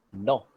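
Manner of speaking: no, clipped, not none. Shown as a demonstration. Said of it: "Not none, it's no".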